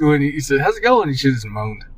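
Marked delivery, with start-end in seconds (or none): 0.6-1.1 high-pitched. Retold or imitated. Acted quote.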